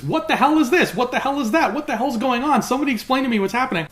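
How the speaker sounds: high, quickening run of bewildered questions; hammed-up, high-pitched bafflement; mock confusion. Imitating Elsie's every sentence to Bernard, mock confused and high. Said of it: "she's so confused".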